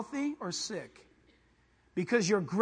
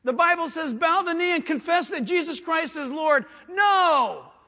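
higher, loud fiery preaching; preaching at full force; 8 semitones higher, 12 dB louder than usual. Acted. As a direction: thunder it at the congregation.

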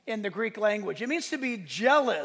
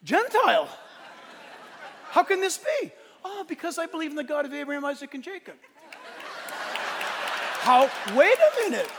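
higher and louder, laughing in disbelief; baffled seller; 6 semitones higher, 4 dB louder than usual. Retold or imitated. Seller can't grasp a Gentile knowing this, disbelieving. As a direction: sputter as if the world just flipped.

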